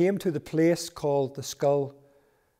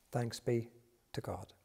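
whispered; 3 semitones lower, 10 dB quieter than usual. Real, not acted. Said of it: "liturgical response".

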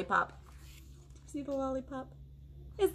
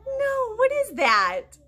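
mock surprise; 11 semitones higher, 7 dB louder, much slower than usual. Mock surprise reading to kids.

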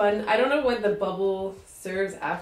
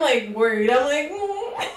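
laughing; 5 semitones higher, 7 dB louder, slower than usual. Real, not acted. Nervous laughter at pot.

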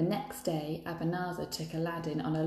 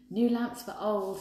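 shouting; 4 semitones higher, 4 dB louder than usual. A narrator's reading. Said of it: "he shouted".

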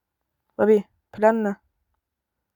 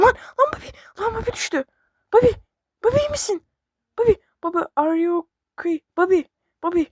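much higher, crying out in alarm; panicked cry; 9 semitones higher, faster than usual. Real, not acted. Gamer crying out in panic as Bobby falls. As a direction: cry out as your friend falls.

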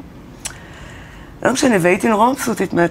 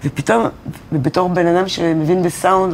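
usual speaking voice

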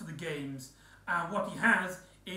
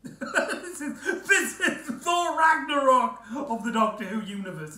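laughing; 7 semitones higher, 7 dB louder than usual. Real, not acted. Laughing at own comparison.

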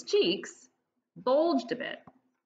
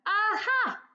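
high, bright discovery shout; triumphant aha; 12 semitones higher, 3 dB louder than usual. Acted. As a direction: pounce on the find with glee. Arthur finds the pump.

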